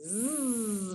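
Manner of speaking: "Zzz" as one long held buzz; soft, sustained "Zzz" buzz; isolated Z sound. Shown as a demonstration. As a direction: hold out "Zzz" like the end of maze. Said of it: "the last sound in maze".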